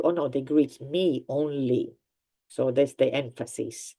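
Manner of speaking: ordinary talk, nothing marked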